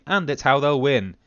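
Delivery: ordinary talk, nothing marked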